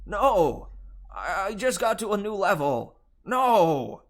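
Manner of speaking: high, loud protest while dragged away; desperate shout. Acted. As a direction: wail about losing the game. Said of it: "as he shouted".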